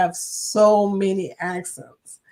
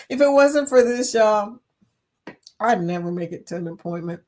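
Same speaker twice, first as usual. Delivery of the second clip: ordinary talk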